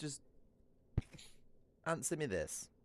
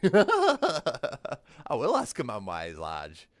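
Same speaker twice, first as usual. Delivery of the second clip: laughing; 5 semitones higher, 11 dB louder, much slower than usual. Real, not acted. Laughing defiance after own rule.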